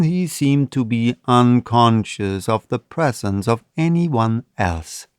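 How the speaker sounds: plain reading voice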